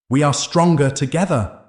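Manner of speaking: high, loud, rousing cry; rallying shout. A narrator's reading. Hero urging townsfolk to hold hands, loud rallying cry.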